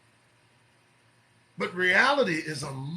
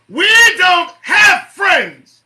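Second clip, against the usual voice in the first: loud, high, drawn-out yell; emphatic shout; 13 semitones higher, 15 dB louder, much slower than usual. Real, not acted. Host driving a point home to listeners, shouting. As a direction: belt it out slowly, word by word.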